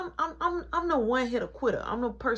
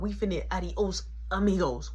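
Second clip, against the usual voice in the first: usual speaking voice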